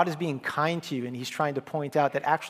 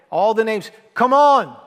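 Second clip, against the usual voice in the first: loud, much higher shout; impatient father's call; 7 semitones higher, 10 dB louder than usual. Retold or imitated. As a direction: call the boys again, fed up. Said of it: "and he'd say".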